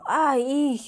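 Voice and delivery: drawn-out demo eww of revulsion; disgusted. Shown as a demonstration. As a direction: react as if passing a pile of trash. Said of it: "쓰레기 더미를 봤어요". (쓰레기를 보고 역겨워하며)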